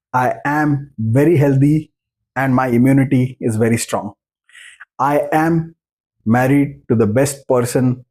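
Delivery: very slow affirmations with pauses after 'I am'; teacher modelling calm, slow affirmations. Shown as a demonstration. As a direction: pause after 'I am', then lay out each phrase slowly. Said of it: "simple technique बताता हूं".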